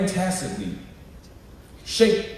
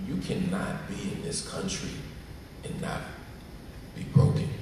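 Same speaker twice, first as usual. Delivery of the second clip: softer, lower, sincere close; quiet earnest appeal; 9 semitones lower, 10 dB quieter than usual. Real, not acted. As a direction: lower your voice and mean every word. Closing earnest plea.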